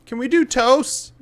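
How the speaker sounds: put-on high, loud child's question; kid calling out. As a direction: ask mom across the room. Kid calling to his mom.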